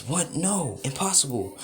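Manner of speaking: high-pitched, stunned protest; shocked disbelief. A narrator's reading. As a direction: cry it out in disbelief. Girl reacts to sudden collapse.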